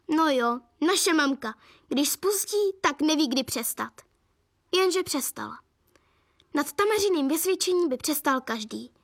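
ordinary delivery, nothing marked

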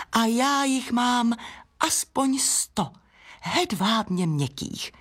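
high, slow, savoured brag; proud boasting. Acted. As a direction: draw each phrase out proudly. Peony proudly counts her petals. (pyšné, pomalé vychloubání)

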